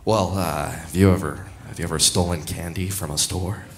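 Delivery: low, soft, hesitant storyteller; mock-grave confiding. Man setting up a prank on a kid, low and hushed. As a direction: lead the child on quietly, low and ominous. Comic sets up scare for kid.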